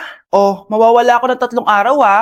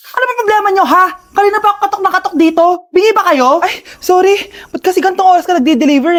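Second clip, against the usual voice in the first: ordinary talk, nothing marked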